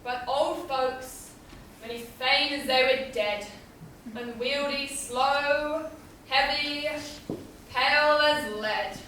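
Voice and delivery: low, soft, dragged-out words. Acted. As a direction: let each word drag like a weight. Impatient girl describes sluggish old folks.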